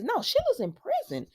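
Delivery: high-pitched retold protest; imitating a startled objection; mock disbelief. Retold disbelieving reply to news.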